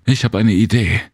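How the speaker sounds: eager idea. A narrator's reading. Serena telling Jem she has an idea, eager. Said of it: "schrie Serena".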